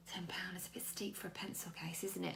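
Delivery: whispered mock penny-pinching aside; stingy whisper. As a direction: hush it like a tight-fisted voice in your head. Mimicking a stingy inner voice.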